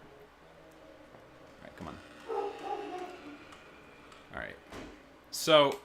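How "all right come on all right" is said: barely voiced whisper, bracing; tense whisper. Real, not acted. Player steeling herself for the jump scare, whispered. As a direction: whisper to yourself before the scare.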